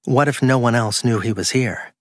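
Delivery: ordinary delivery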